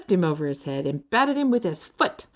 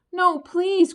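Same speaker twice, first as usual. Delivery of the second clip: high, loud desperate plea; crying out; 11 semitones higher, 7 dB louder than usual. A narrator's reading. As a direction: cry out to save your friend. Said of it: "cried Ferd".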